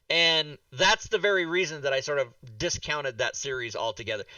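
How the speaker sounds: normal talking voice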